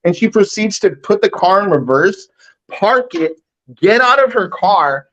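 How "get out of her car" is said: ordinary talk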